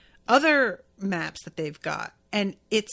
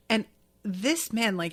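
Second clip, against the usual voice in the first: ordinary talk, nothing marked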